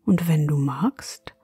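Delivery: whispering. Acted. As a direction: hush them toward sleep. Bedtime story intro whisper.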